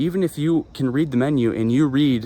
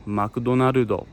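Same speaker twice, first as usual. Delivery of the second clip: Japanese pronunciation; slower than usual. Retold or imitated. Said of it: "they say".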